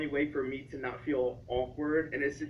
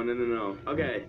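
ordinary talk

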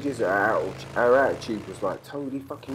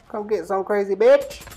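higher and louder, daring her to come; taunting shout; 10 semitones higher, 6 dB louder than usual. Real, not acted. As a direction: call out the challenge across the field. Taunting a charging enemy.

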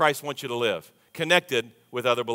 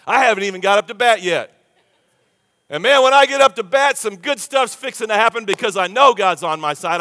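much higher and louder, triumphant; excited preaching; 5 semitones higher, 7 dB louder than usual. Real, not acted. Preacher rallying the congregation, loud and triumphant. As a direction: lift the room with rising joy.

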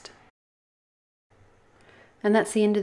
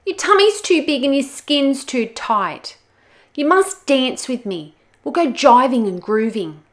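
higher, louder bossy insistence; pushy demand; 7 semitones higher, 10 dB louder than usual. Acted. Olga bossing the crocodile to dance, loud pushy demand. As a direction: insist she must dance.

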